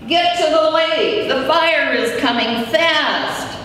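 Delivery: high called-out warning; town crier. Retold or imitated. Father warning people in town.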